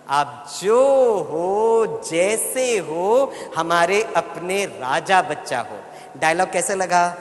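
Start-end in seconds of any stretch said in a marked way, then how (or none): 0.1-5.8 slow, loving singsong with long-held words; बहुत प्यार से. Shown as a demonstration. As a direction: draw each word out lovingly for the children. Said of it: "आप बहुत प्यार से बच्चों से मिलकर कहना". (बहुत प्यार से, धीरे-धीरे खींचकर)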